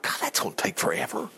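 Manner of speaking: soft whispered grumble; whispered aside. Retold or imitated. Preacher recalling his reading, whispered aside. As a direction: breathe it like a private complaint. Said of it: "And I'm like".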